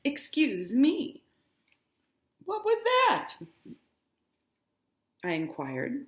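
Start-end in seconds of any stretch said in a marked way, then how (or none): 0.0-1.2 policeman voice. Acted. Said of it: "agreed the policeman". 2.5-3.3 high put-on voice. Nick asks, high odd voice.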